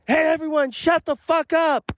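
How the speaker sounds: high-pitched imitation of a kid yelling; imitated yell. Seventh grader silencing the class for teacher, imitated high yell.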